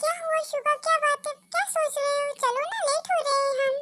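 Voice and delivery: ordinary delivery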